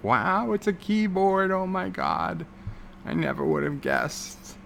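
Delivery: high-pitched fake wonder, easing down by the end; mock amazement. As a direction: marvel like an easily amazed beginner. Mocks beginner's amazement at picture.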